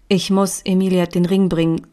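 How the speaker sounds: usual speaking voice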